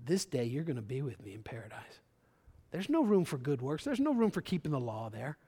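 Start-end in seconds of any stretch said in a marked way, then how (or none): 0.1-2.0 softer, lower, tender promise; gentle reassurance. Retold or imitated. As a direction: speak it as quiet comfort. Jesus' gentle reply retold.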